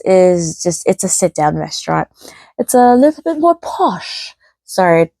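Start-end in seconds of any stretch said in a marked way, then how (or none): 2.6-4.3 high, hammed-up posh British accent; mock British accent. Vlogger joking that table service is posh, in a bad British accent. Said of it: "that was a horrible accent".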